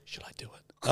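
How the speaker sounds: breathy unvoiced whisper, asking permission; whispered sideways to a friend across the table. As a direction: hush it like a nervous secret question. Quoting her nervous question at work.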